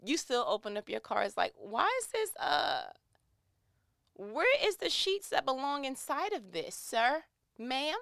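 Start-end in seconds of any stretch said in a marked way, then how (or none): 1.6-8.0 high puzzled voice peering into the card; playfully baffled searching for the money; mock confusion, pitched up.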